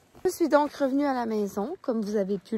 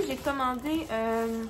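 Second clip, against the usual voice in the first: ordinary talk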